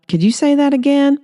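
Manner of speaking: high disbelieving ask; incredulous. Acted. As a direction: ask again, sure he misheard. Earl can't believe his ears.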